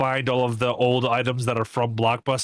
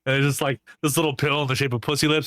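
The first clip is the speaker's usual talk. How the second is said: ordinary delivery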